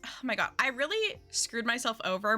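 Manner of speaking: usual speaking voice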